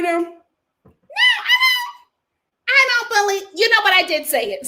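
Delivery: very high, loud mock shriek that settles into a quick admission; mock yelling denial. Kiana confronted about the chin check, high loud mock shriek. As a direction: squeal the denial, then give in fast.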